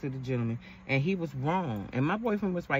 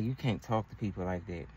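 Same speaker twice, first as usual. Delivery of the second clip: put-on lower, softer, calm boyfriend correcting her; 5 semitones lower, 5 dB quieter than usual. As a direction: quietly lay down the rule like a patient boyfriend. Boyfriend correcting her, voiced low.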